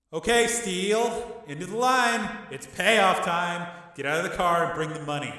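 high, slow, drawn-out call. Acted. Gangster summons Steele to payoff, calling down slowly. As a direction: call across an arena from high seats. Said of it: "from somewhere up in the seats".

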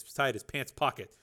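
ordinary delivery, nothing marked

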